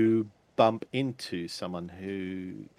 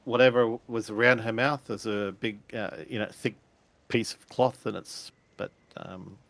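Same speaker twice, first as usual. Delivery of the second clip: ordinary delivery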